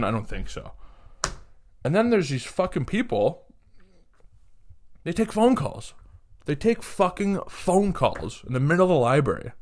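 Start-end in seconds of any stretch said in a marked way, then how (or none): none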